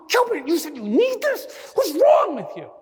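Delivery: retold angry rant, very high and loud; outraged, high-pitched shouting; yelling at audience. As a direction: berate the whole crowd at full pitch. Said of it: "don't yell at the audience".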